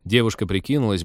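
ordinary delivery, nothing marked

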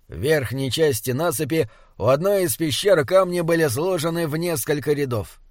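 ordinary delivery

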